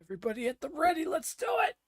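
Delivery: high, fast rallying cry; rally the crew; rallying shout. Acted.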